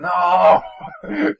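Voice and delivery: loud surprised cry of no; surprised shout. Real, not acted. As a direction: yell no in shock. Reacting to friend's birthday.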